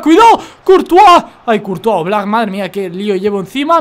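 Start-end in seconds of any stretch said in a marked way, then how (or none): 0.0-1.2 sudden high alarmed yell; alarmed shout. Real, not acted. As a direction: shout a warning at the shot. Near-miss shot on goal.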